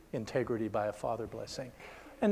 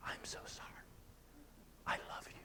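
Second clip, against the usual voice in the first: barely voiced, much softer whisper; tearful whisper; 19 dB quieter, faster than usual. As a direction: breathe it out like a broken apology. Old man apologizes to son.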